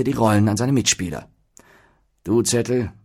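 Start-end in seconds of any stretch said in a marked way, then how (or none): 2.2-2.9 with mild pomp, addressing the troupe; a touch of stately authority; dignified. A narrator's reading. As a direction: hand out the part with gravity. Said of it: "sprach er würdevoll".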